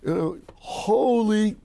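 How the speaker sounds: high, loud, slow with laughter; overjoyed. Real, not acted. Telling how he met his hero, gleeful excited shout. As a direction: burst out in gleeful disbelief.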